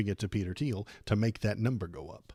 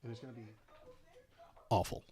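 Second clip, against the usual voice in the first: ordinary talk, nothing marked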